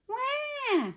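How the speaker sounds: put-on high-pitched baby wail; baby's cry. A narrator's reading. Hungry baby ghost in the cradle, high crying wail. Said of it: "cried Baby Ghost".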